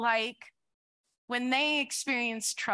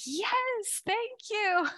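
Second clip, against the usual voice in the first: high, bright eager reply; higher, delighted mock yes; 9 semitones higher, much slower than usual. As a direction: chirp it back happily. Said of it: "I'm like".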